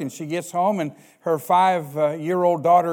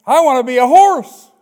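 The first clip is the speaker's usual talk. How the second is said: much higher and louder punchline shout; excited child; 7 semitones higher, 10 dB louder than usual. Retold or imitated. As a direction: blurt it out as the gleeful kid. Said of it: "five-year-old daughter".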